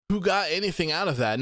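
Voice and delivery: ordinary delivery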